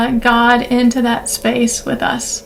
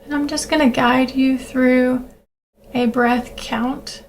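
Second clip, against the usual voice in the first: calm breathing-exercise voice with long pauses; guided meditation; 3 dB quieter than usual. Acted. Leads a grounding breathing meditation.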